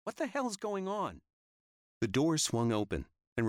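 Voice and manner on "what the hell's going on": high woman calling out; woman's voice. Acted. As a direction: call from down the hall. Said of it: "I heard her say".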